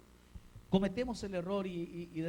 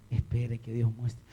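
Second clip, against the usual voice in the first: low, slow answer; pastor's restrained reply; 7 semitones lower, slower than usual. Acted. Pastor answers an insistent member, low and restrained. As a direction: drop right down and hold them off slowly. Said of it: "No, le digo yo".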